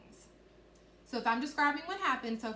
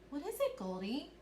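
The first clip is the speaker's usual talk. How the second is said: higher, rising storybook question; question intonation; 4 semitones higher than usual. Shown as a demonstration. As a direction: ask as a curious story character, voice going up. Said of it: "when I asked a question, I made my voice go up".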